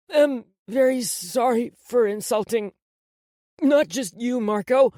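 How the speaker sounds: high, loud, halting apology; tearful hiccuping. A narrator's reading. Crying boy apologises to Marco, tearful. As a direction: hiccup through it in a child's tearful voice. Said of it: "he hiccuped".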